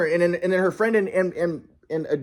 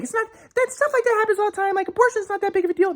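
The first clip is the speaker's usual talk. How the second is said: much higher, airy dismissive parody; mocking Sylvie; 12 semitones higher than usual. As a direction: make her sound careless and dismissive.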